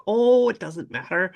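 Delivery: high-pitched imitation of a Scottish woman; lilting mock-Scottish voice; Scottish accent. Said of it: "full on Scottish, Scottish accent".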